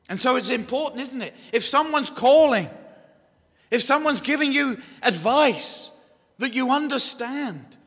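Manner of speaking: high, loud, insistent pulpit delivery; forceful preaching. Real, not acted. Preacher pressing listeners to understand advice, forceful preaching.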